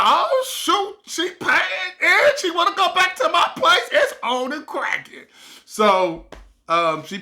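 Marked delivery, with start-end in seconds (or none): 0.0-5.2 high, excited inner voice; bursting with excitement at his luck; thrilled. Retold or imitated. Thrilled she will come over.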